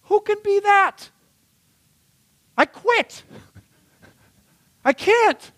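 very high, loud mock wail; imitated despairing women. Preacher voices women giving up on the ideal, high mock wail. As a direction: squeal in hammed-up defeat. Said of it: "They go like".

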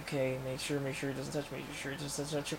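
murmured repeated caution to oneself; careful self-talk. Acted. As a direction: coach yourself very softly through a delicate task. Acts out Peter cautiously handling symbiote.

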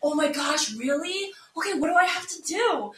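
lightly put-on eager victim; playing along. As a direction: imitate someone feigning astonishment to string a scammer along. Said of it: "messing around with it".

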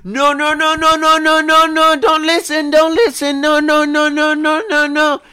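very high, frantic pleading no no no; panicked scammer. Retold or imitated. Scammer overhearing the son begging mom not to listen, high frantic pleading. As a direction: beg desperately in a squeaky voice.

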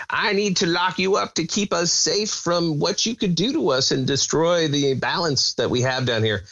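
lightly voiced old woman; a hint of an old lady giving orders; ridiculous old person voice. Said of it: "in her totally ridiculous old person voice".

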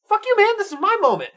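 high-pitched mock-indignant protest; hammed-up outraged little brother; indignant outburst. Retelling the brother's protest to his friend, hammed-up indignation.

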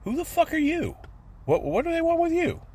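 high, rapid dad scoffing at the paranoia; dad's incredulous retort. Retold or imitated. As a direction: squeal it at his brother like he can't believe it. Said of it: "my dad would turn to him and go".

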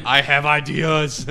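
slow, drawn-out imitation of a friend; hammed-up quote, unlike own voice; Brian. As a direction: stretch it out as a teasing impression. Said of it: "Brian's like".

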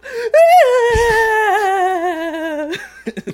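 high, loud howling laugh; loud laughing fit. Real, not acted. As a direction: crack up helplessly.

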